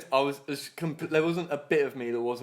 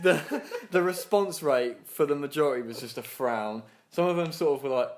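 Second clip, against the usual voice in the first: normal talking voice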